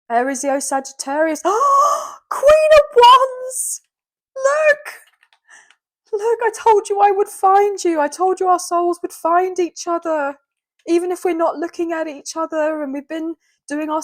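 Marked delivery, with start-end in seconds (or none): 1.5-2.2 sharp high gasp of delight; excited gasp. Real, not acted. Tarot card reveal, sharp gasp. 2.3-4.9 much higher and louder, drawn-out thrilled cry; excited cry. Real, not acted. Tarot reader spots matching card. 6.1-12.8 higher and louder, joyful insistence; elated. Real, not acted. Ecstatic over the card pairing.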